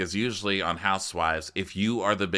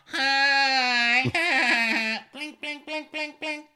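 airhead voice; much slower than usual. Retold or imitated. Caroline impression, vacant greeting.

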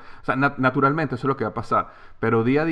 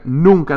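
NUNCA punched higher and louder; emphatic never; 5 semitones higher, 8 dB louder than usual. Real, not acted. Coach stressing to listeners, NUNCA punched hard. Insisting he never gets tired.

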